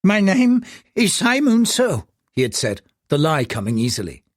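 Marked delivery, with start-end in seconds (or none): 0.0-2.1 put-on high, humble old caller; high, ingratiating old man's voice; wheedling old man. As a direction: flatter the powerful man on the phone.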